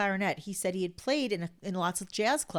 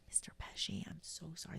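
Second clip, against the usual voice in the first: whispered apology, almost no voice; discreet whisper; 16 dB quieter than usual. Retold or imitated. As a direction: lean in and whisper it politely. Waiter discreetly asks him to stop.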